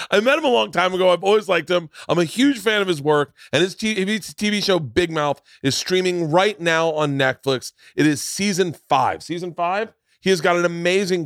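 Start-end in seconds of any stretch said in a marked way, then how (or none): 0.1-5.1 brighter, higher and quicker; upbeat host restart. Shown as a demonstration. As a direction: start over with upbeat energy. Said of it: "we should start this over".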